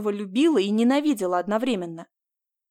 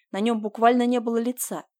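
ordinary delivery

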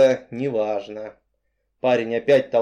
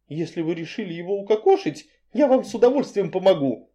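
eager nervous gabbling; rattling off; 6 semitones higher than usual. Acted. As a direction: gabble it out all in one go. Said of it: "затараторила я".

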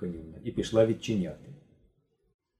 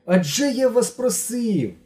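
high, loud, slow reproach; much higher upset plea; pleading protest; 11 semitones higher, 5 dB louder, slower than usual. A narrator's reading. Genka protests after shout.

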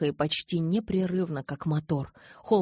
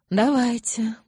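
higher, loud but uninvolved repeat; indifferent echo; 7 semitones higher, 6 dB louder, slower than usual. A narrator's reading. Elder repeating his fellow's assent, indifferent. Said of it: "равнодушно повторил Марк".

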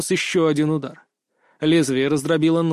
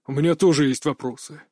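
ordinary delivery, nothing marked

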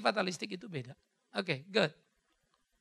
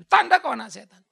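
loud rebuke; 4 semitones higher, 12 dB louder than usual. Retold or imitated. Jesus rebukes the Pharisees.

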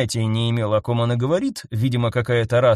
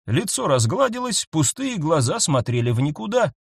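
usual speaking voice

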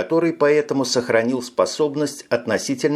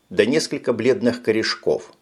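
ordinary delivery

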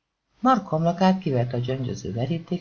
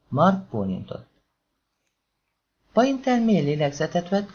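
plain reading voice

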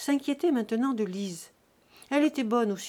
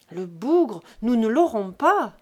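loud, despairing outburst; a sweating farmer shouting over his cow, loud; desperate shout; 10 dB louder than usual. Acted. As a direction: bellow it out in frustration.

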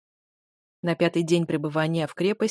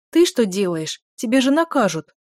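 indignant and louder, voice jumps up; 3 semitones higher, 6 dB louder than usual. Acted. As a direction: scold him in alarm. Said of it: "Возмутилась я".

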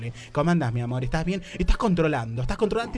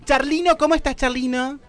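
excited greeting; 10 semitones higher, 7 dB louder than usual. Acted. Host greeting puppet guest.

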